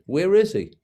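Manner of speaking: high, loud imitated New York doctor; New York accent. Doctor asking after his old friend in a New York accent. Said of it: "the most New York accent".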